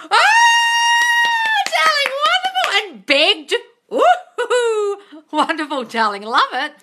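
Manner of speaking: squealing high with joy, laughing; delighted. Real, not acted. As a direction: gush with delight over the news. Thrilled by viewer's success.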